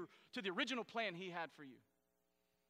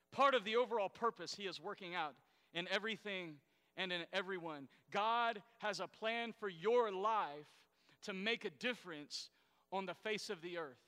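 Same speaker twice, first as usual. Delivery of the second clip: ordinary talk, nothing marked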